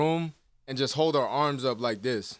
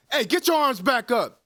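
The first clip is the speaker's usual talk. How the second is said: dad's order; 6 semitones higher, 3 dB louder than usual. Retold or imitated. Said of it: "they'd be like".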